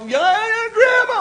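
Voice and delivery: put-on high, loud kids' yelling; yelling kids. Grandsons yelling for Grandma from the steps, high and loud. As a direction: shout it like excited grandsons. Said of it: "grandsons are yelling and screaming".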